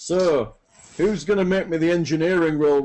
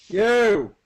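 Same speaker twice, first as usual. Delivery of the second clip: higher, louder lordly shout; commanding call; 7 semitones higher, 5 dB louder than usual. Acted. Lord summoning a gang by the tower, commanding call. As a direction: call out sharply to get their attention. Lord calling to workers in game.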